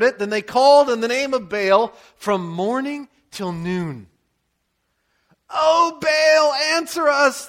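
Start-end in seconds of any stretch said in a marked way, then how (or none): 5.5-7.5 loud high desperate cry to a god; shouting. Acted. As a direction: call out to Baal at full voice, pleading. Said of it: "they shouted".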